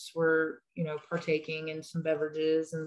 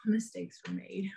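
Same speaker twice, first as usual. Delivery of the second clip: soft unvoiced whisper; 2 semitones higher, 6 dB quieter than usual. Real, not acted. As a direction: breathe it like a guilty aside. Quiet deadpan aside.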